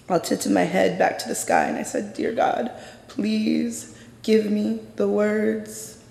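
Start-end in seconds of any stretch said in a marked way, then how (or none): 2.1-6.0 slow, high, hushed plea to God; hushed prayer. Retold or imitated. Frozen under a cop's gun, hushed slow prayer to God. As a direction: pray under your breath, slowly, begging. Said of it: "I started praying and asking my ancestors to come".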